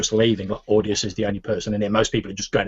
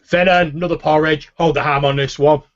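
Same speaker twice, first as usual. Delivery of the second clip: loud, higher barkeep calling an order; Nyog; 6 semitones higher, 6 dB louder than usual. Acted. Orc barkeep ordering from the cook, calling out. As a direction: call the order to the back, raised voice. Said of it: "The orc Nyog behind the bar says".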